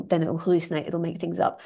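ordinary talk, nothing marked

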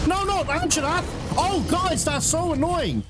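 high-pitched exasperated protest; real annoyance, pitch leaping right up; pissed off. Real, not acted. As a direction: cry out in frustration as it goes wrong. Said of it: "I am so pissed off".

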